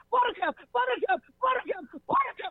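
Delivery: very high cartoon squeal; tiny high-pitched cartoon voice; Bugs Bunny. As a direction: squeak it repeatedly in giddy celebration. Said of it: "I was like Bugs Bunny".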